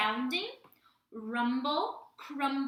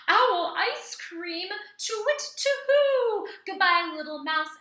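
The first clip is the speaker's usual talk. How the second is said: high, loud panicked owl hooting; alarmed owl; 9 semitones higher, 10 dB louder than usual. Acted. Owl fleeing the mouse, high loud alarm. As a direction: cry out in high-pitched alarm.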